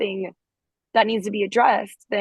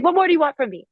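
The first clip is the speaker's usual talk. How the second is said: accusatory; 7 semitones higher, faster than usual. Shown as a demonstration. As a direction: snap the question back, fed up. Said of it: "say it in, like, an accusatory manner".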